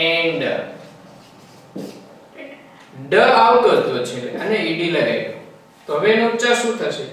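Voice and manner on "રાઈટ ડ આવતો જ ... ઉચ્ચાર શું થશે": usual speaking voice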